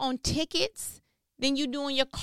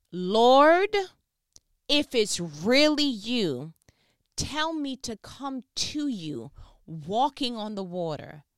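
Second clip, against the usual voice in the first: a slow, earnest Peter, Lord called out loud; dramatic Peter; 6 dB louder, slower than usual. Acted. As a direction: call out to Jesus, then ask slowly. Voicing Peter calling to Jesus again.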